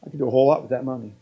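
quick, low, muttered grumble of a reluctant giver; grudging giver. Retold or imitated. Church member grumbling about giving money, low and quick.

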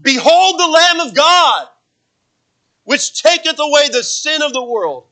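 "Behold" rung out high and loud, slow and ringing; "Behold the Lamb of God" cried out like an exclamation point; passionate exclamation. Shown as a demonstration. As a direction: proclaim it with fire, slowly. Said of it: "you see that there's passion in this".

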